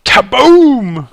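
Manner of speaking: loud, high 'kaboom' shout; booming explosion cry. Pretend. Player voices an explosion at the table, booming. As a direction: blast it out like an explosion.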